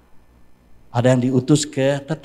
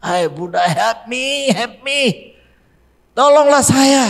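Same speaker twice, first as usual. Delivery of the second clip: retold plea, pitched higher and louder; desperate cry to Buddha; 12 semitones higher, 7 dB louder than usual. Preacher voicing Yonggi Cho begging Buddha for help, higher and rising. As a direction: cry out for help like a sick young man. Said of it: "menangis, berteriak, berdoa kepada Buddha".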